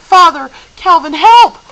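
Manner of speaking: piercing, very high and loud scream for help; crying out for help. A narrator's reading. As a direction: shriek for rescue at full force. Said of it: "Meg cried".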